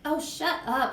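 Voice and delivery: put-on fed-up boy's retort; annoyed retort. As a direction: shut her up crossly. Boy snaps at crying Sheila.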